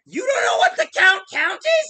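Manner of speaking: loud, incredulous shout; shocked disbelief. Real, not acted. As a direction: yell it in utter disbelief. Friend doesn't know the Count.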